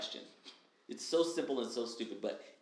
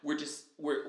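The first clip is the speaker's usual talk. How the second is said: ordinary talk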